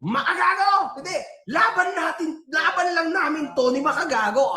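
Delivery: hammed-up angry outburst; mock angry shout. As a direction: shout it like he's furious. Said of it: "Sabi niya, sabi niya".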